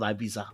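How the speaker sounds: Ibiza softly as "eye-BEE-za". Shown as a demonstration. Host cites the Spanish way, soft Ibiza as eye-BEE-za. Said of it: "check the Spanish way of saying it".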